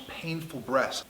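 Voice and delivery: ordinary talk, nothing marked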